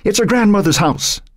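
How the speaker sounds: put-on high-pitched, alarmed girl's voice; gasped alarm. A narrator's reading. Cheerleader running up to Matt with bad news, lightly gasped. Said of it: "Kelly gasped as she came up".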